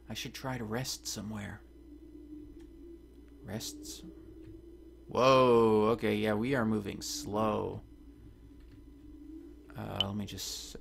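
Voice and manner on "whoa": startled whoa. Real, not acted. Sudden game event.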